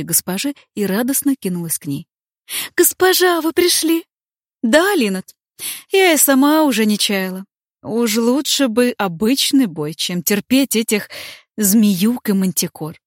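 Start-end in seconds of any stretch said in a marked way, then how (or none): 2.8-4.0 put-on high, eager young maid; радостная служанка. Горничная радостно кидается к госпоже. 4.6-13.0 weary heroine. Acted. Бранка устало жалуется служанке.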